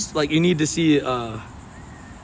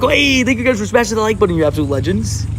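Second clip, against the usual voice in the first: excited shoutout; 7 semitones higher than usual. Real, not acted. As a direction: pump up the chat with a quick, high thank-you. Chat shoutout to viewers.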